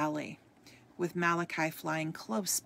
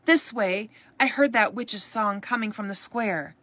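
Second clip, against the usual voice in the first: loud, high call to rally the crowd; raised-voice alarm from an angry mob; mob cry; 4 semitones higher, 8 dB louder than usual. A narrator's reading. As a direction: call out like a villager raising the alarm. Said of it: "the cries of many voices".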